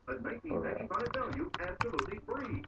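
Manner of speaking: hard sell ad. Acted. TV pet product commercial.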